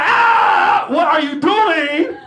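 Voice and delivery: high yelp, then a drawn-out alarmed question; shrieked protest at being poked; startled yelp. Retold or imitated. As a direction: jump out of your skin. Startled by girlfriend's prank.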